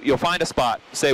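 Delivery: ordinary delivery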